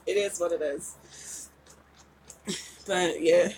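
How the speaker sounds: ordinary delivery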